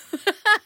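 a sudden high, loud laugh; high burst of laughter. Real, not acted. Laughs at the clip's punchline.

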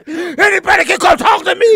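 high, loud mock yell; hammed-up fired-up yell; imitating an angry shout. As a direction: shout it like a threat. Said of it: "Your passion sound like you about to whoop your ass".

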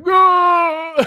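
high, loud drawn-out "no"; retold horrified yell. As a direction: cry out in mock horror. Retold panicked reaction to wife's question.

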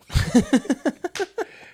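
high laugh at his own punchline; real amused laughter. Real, not acted.

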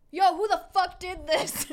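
loud, high retold yell at the kitchen; half-playful imitation of a furious yell; mock yell. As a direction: bark it like an outraged worker. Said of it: "and he's like".

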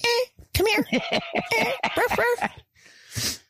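high little yips and ruffs; imitated dog noises. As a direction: mimic a yappy dog calling for attention. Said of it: "She'd just be like".

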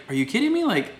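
high-pitched, exasperated disbelief; can't believe they lost to them, voice shooting up; incredulous. Real, not acted. Outraged disbelief at a loss.

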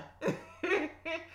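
high, breathy little laugh; chuckle. Real, not acted. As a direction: chuckle at your own confusion.

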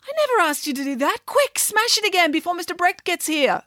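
very high, outraged schoolgirl outburst; shrill exclamation. Acted. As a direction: exclaim it high-pitched, in protest. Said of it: "exclaimed Mirabella".

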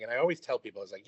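ordinary talk, nothing marked

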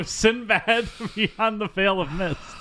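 high, slow announcer voice; dramatic trailer voice. Pretend. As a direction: announce the title grandly. Dramatic movie title.

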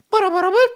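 very high, loud computer beeps; imitated electronic beeping. Mimicking an online call connecting, high beeping noise. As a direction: sing out shrill connection bleeps.

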